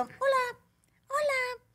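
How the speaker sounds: put-on high, sing-song kids' entertainer voice; voz aguda de animadora. Saludando a una niña, voz infantil. (voz aguda y cantarina de animadora infantil)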